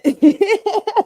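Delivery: high, loud cackling laugh; burst of laughter. Real, not acted. Streamer losing it over Kamala's accent, loud laughter. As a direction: crack up helplessly.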